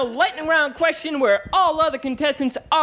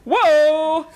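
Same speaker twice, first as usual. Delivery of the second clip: startled whoa; 5 dB louder than usual. Acted. Host startled by outburst.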